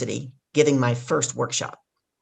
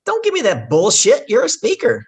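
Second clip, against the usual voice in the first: loud, high, forceful scolding; blunt rebuke; 9 semitones higher, 6 dB louder than usual. Retold or imitated. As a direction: cut through the excuses, loudly and emphatically. Retells woman's blunt rebuke.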